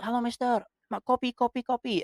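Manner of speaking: high-pitched calling out, imitated; repeated high call, playfully acted; mock villager's invitation. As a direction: beckon a passer-by in, imitating an eager host. Villager calling walker in for coffee.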